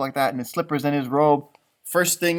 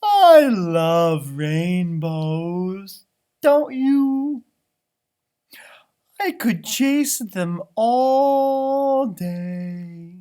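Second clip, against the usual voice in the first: high, slow and sunny puppy; cheerful puppy voice; 5 semitones higher, 7 dB louder, much slower than usual. Acted. Puppy Lucky chirping to Mackenzie about rain. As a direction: bubble over like a happy little dog. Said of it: "Lucky said".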